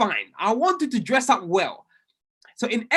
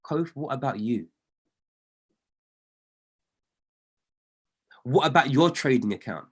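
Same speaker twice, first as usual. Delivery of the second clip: lower, calm divine question; God's voice; 7 semitones lower than usual. Retold or imitated. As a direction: ask it gently from above, a touch lower. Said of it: "God was like to me".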